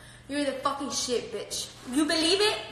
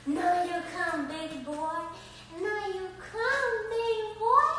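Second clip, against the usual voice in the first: cooing pet talk; 5 semitones higher, 4 dB quieter, slower than usual. Real, not acted. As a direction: coo it to the dog, drawn out. Cooing at her dog.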